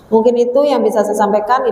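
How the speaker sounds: ordinary delivery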